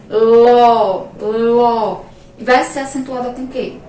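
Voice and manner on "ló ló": ló shouted loud with an open ó; stressed syllable. Shown as a demonstration. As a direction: punch ló hard and loud. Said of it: "Ó, sílaba mais forte".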